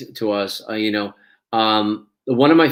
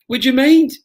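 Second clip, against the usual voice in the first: high, loud, pleading mock-weepy voice; mock tearful; 5 dB louder than usual. Actor asking a co-star off camera, mock tearful. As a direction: squeak out a tearful request. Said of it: "I'm crying because".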